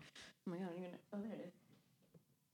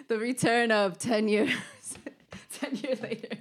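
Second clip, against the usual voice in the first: laughing; 5 semitones higher, 4 dB louder than usual. Real, not acted. Laughing mock announcement.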